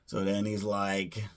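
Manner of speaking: normal talking voice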